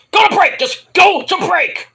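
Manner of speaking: high, loud mock order; frantic mock shout. [name] yelling at the camera crew, frantic mock shout. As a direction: shout it to the crew, stretching the repeat.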